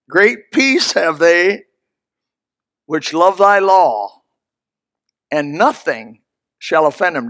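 slow, solemn reading of the verse; scripture reading. Acted. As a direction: lay out each word of scripture. Quoting scripture.

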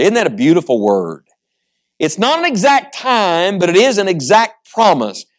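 usual speaking voice

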